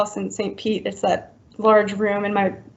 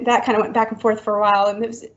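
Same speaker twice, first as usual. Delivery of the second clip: normal talking voice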